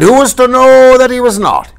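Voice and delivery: high, loud rhetorical question; old pirate crowning his tale, high and loud; triumphant flourish. Acted. Ryan ends his explanation triumphantly.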